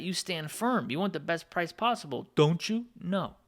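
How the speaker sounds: normal talking voice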